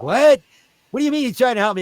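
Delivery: high confused question; puzzled character. Retold or imitated. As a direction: play the baffled clown. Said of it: "And you're like".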